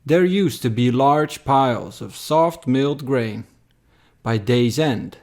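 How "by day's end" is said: plain reading voice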